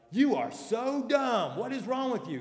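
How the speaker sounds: exasperated scolding. Retold or imitated. Pastor voicing how people react to others' failings, exasperated. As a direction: snap at someone who keeps messing up. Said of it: "we would be like".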